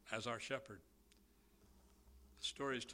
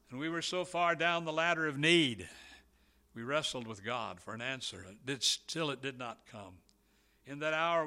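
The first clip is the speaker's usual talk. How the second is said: loud, rising sermon climax; preacher thundering to the congregation about wrestling with God; preaching at full force; 8 dB louder than usual. Acted. Shouted preaching.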